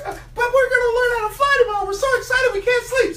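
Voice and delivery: high, fast chick voice with laughter; giddy little bird who can't sleep; excited baby eagle. Acted. Eaglets answer dad about bedtime, high giddy squeal.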